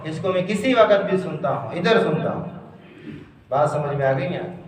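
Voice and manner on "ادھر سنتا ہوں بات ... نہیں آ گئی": ordinary talk, nothing marked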